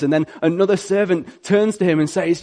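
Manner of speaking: usual speaking voice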